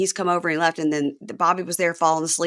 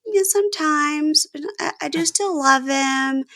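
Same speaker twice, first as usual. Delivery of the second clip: gently voiced mom; 7 semitones higher, slower than usual. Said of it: "she goes".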